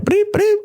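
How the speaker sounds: very high-pitched electronic bleeps; squeaked dialing noise; imitated phone beeps. Imitates the phone while calling.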